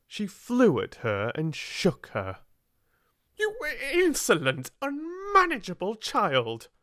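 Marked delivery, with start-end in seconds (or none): 3.4-6.7 much higher, enraged scolding; furious cry. Acted. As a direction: shriek the insult at the child. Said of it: "she cried".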